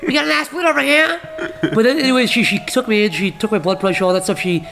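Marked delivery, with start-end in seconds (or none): 0.1-1.2 high-pitched mock announcement across a ward; hammed-up incredulous hospital staffer calling colleagues; nurse calling out. Acts out nurse announcing embarrassing patient.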